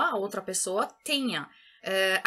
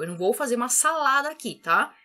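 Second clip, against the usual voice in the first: ordinary talk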